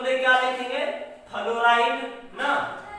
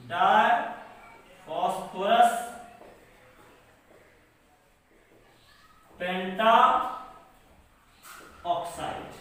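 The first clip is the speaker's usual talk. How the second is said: lower, word by word, long pauses; dictation; 5 semitones lower, slower than usual. Shown as a demonstration. Teacher dictating phosphorus oxide's name for writing, measured dictation. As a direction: dictate each part separately and low.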